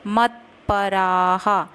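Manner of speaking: मत्पराः ending with a clear echoing ha; visarga as ha. Shown as a demonstration. Teacher showing students the visarga sound in मत्पराः. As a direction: say मत्पराः as matparaha, slowly. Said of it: "उसके बाद हम हा बोलेंगे".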